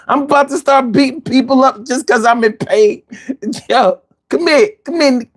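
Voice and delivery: high-pitched, worked-up cursing; hammed-up agitated street rant; acted pained outburst. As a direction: rant as if pain has you ready to fight.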